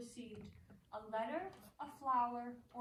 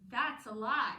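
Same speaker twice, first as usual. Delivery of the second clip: much louder, flung straight back; indignant outburst; 9 dB louder, slower than usual. Acted. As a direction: throw the accusation back at her. Actress rejects the accusation.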